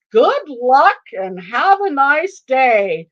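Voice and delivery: loud, slow, drawn-out send-off; departing officer. Retold or imitated. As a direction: call it back over the shoulder, stretching each phrase. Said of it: "over his shoulder he says".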